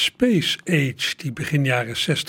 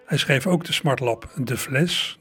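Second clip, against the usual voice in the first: ordinary talk, nothing marked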